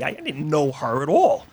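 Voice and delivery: ordinary talk, nothing marked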